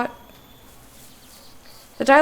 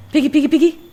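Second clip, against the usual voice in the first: sing-song loud call to a pig; calling the piggy; 6 semitones higher, 5 dB louder, much faster than usual. Real, not acted.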